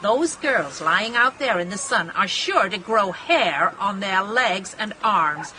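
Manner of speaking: usual speaking voice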